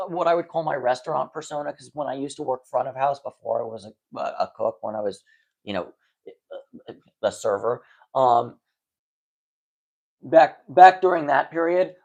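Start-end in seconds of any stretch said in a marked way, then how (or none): none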